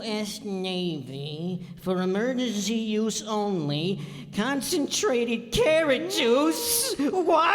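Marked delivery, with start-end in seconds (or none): 4.4-7.5 shocked outburst. Acted.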